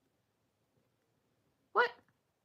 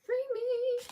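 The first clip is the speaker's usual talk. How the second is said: 1 mock plea. Fighting through a crochet row.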